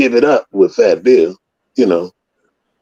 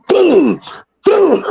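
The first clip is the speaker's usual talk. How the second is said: imitated punches. Mimicking punches.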